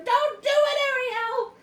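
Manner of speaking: high, loud desperate cry; pleading shout. Real, not acted. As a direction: yell a warning at the page. Shouting at the character.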